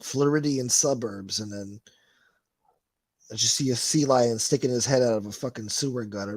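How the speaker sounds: ordinary talk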